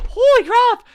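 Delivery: loud, high-pitched astonishment; shocked exclamation. Real, not acted. Streamer reacting to a band mafia story, shocked exclamation.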